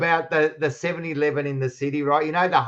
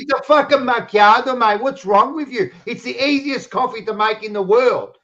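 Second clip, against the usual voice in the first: exasperated rant; 7 semitones higher than usual. Retold or imitated. Ranting retelling of bad coffee.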